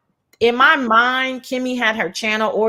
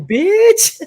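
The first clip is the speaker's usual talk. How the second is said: sassy; 12 semitones higher, 5 dB louder than usual. Retold or imitated. Mocking Tracy's hotel.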